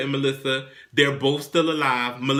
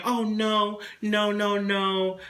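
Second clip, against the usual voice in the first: lightly mimicked coy protest, higher pitch; playing reluctant; 5 semitones higher than usual. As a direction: put on a higher voice for a mock "oh no". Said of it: "[name]'s playing".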